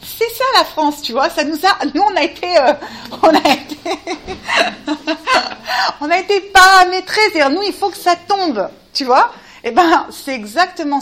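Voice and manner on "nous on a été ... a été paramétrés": en riant. Real, not acted. Rit de lui-même.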